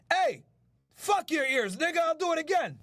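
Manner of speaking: teasing yell aimed at complaining viewers; defiant shout. Real, not acted. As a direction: shout it right back at them, daring. Yelling back at viewers over ears.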